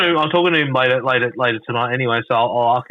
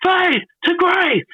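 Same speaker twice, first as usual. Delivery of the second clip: much higher, slow drawn-out announcer call; Hugh Sands catchphrase; much slower than usual. Retold or imitated. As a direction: stretch it out like a ring announcer. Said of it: "Hugh Sands going".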